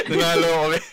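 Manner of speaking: fast, laughing boast; laughing excitedly. Real, not acted. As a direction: laugh through the words quickly. Laughing about winning the raffle.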